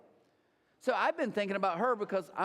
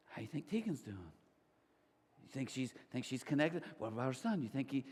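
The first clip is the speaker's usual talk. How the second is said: low, soft imitated fretting; hushed, low concern; quiet worried asking; 5 semitones lower, 6 dB quieter than usual. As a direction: ask softly, as at home. Wife worrying about children at home.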